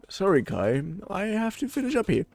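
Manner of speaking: apologetic figure, pitch climbing high through the line; retried character voice. Acted. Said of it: "what the fuck did I use for a voice for him".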